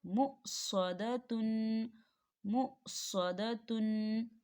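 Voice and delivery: मुसदतुन read very slowly, हमज़ा not stretched; slow recitation of मुसदतुन. Shown as a demonstration. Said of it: "कैसे?".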